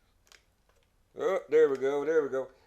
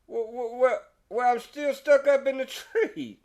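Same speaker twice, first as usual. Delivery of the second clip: much higher, baffled protest with a laugh; exasperated neighbor; 9 semitones higher than usual. Acted. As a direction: sputter in disbelief.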